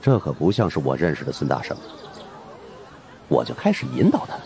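plain reading voice

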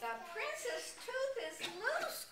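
high, slow storybook alarm; hammed-up high cry of concern. A narrator's reading. Nanny announces the prince's loose tooth, crying out high. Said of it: "cried the nanny".

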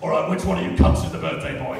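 low, fast rough clown; gruff clown voice. Pretend. Rude clown voice.

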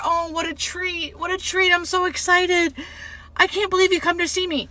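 high voice, savoring the delight; happy, thrilled patient. Retold or imitated. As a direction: imitate a patient delighted by a visit. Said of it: "so happy and so pleasant".

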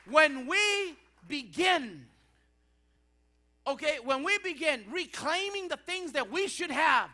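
loud, high, full-force sermon delivery; impassioned preaching. Real, not acted. Preacher telling congregation none of us deserves it, fervent and loud.

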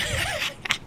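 high-pitched laugh; high giggle. Real, not acted. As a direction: giggle at the silly weapon. Laughing at jar cannon.

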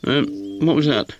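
jumpy little question in the dark; nervous. A narrator's reading. Puppy hearing a noise at night, nervously asking. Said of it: "said Towser nervously".

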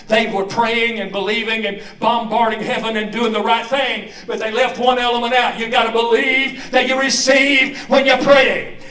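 loud, driving revival preacher; full-force preaching. Real, not acted.

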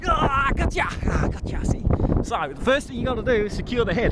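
loud, keen wildlife-wrangler; loud excited. Pretend. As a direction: shout it mid-wrestle. Loud excited croc-wrestling host.

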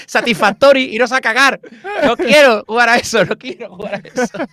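high, loud, hammed-up refusal; mock angry rant. Guest rejecting popular games he hates, loud playful tantrum. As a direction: stomp your foot like a sulky kid.

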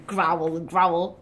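low spoken growl; mock growl. Playing the wolf for the kids, a low spoken growl.

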